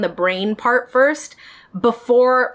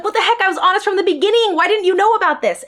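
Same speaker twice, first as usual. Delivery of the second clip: higher, quick indignant outburst; exasperated disbelief; 8 semitones higher, faster than usual. Retold or imitated. Venting at the therapist who never read her chart, higher and exasperated. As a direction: throw it back at them, baffled and annoyed.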